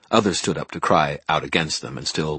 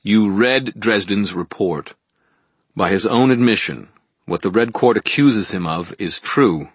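slap the fact down on the table; curt angry reply; snapped; 4 semitones higher, 6 dB louder than usual. Acted. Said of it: "Fortier snapped".

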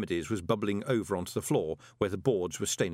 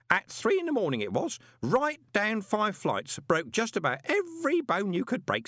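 put-on high, chatty old clerk; fussy old postal clerk; old man voice; 8 semitones higher than usual. Groat's voice in dialogue.

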